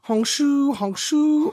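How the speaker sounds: mock snoring in sleep; snoring sounds. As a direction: fake snore.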